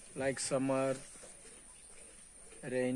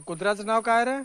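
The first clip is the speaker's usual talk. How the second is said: quick, higher call across the yard; 9 semitones higher, 5 dB louder, much faster than usual. Real, not acted. Farmer calling out to his nephew about the dog's name, quick and raised. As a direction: shout the question over to him, fast.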